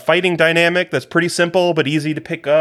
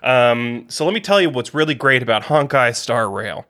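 ordinary delivery

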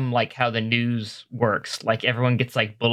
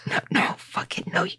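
whispering; 4 dB quieter than usual. Real, not acted. Whispered reply.

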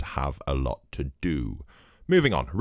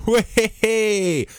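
silly squeal; 7 dB louder than usual. Acted. Punchline celebration squeal.

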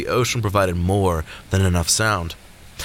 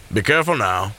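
plain reading voice

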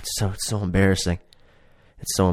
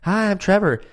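mimicking; 11 semitones higher than usual. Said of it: "She goes".

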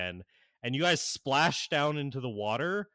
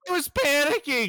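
Gizmo panicking; 8 dB louder than usual. Acted.

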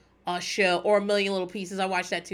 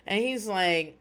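ordinary delivery

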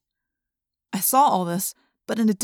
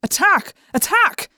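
yelling; 10 semitones higher, 4 dB louder, slower than usual. A narrator's reading. Controller yelling to attack.

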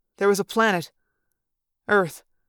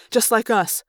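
ordinary delivery, nothing marked

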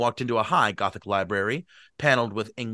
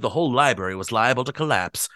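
ordinary delivery, nothing marked

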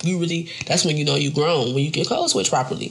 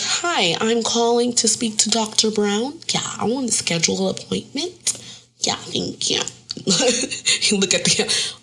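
higher, polite customer-service phone voice; 5 semitones higher than usual. Retold or imitated. Mom phoning the doctor's office, in a prim code-switched voice.

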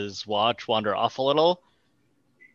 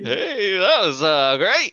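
high, overly bright nervous cheer; nervous cheerful; 9 semitones higher, 5 dB louder, slower than usual. Retold or imitated. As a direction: cover the fear with cheer.

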